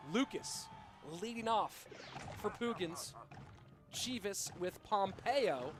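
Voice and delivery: slow, drawn-out caster call; live play-by-play. Acted. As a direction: announce the opening like a match caster. Calls the match start.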